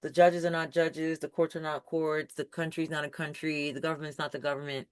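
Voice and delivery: low, soft mock recital; imitating naysayers. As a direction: rattle off their stock complaints quietly, imitating them. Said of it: "you hear people saying it".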